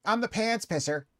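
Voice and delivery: high mocking impression of a streamer; imitating Harmon. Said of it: "listen to him say it".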